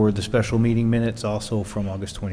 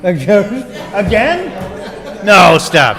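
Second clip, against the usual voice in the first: laughing; 10 semitones higher, 10 dB louder, much slower than usual. Real, not acted. Laughing at repeated vote.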